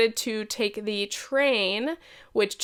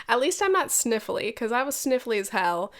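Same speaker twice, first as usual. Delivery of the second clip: usual speaking voice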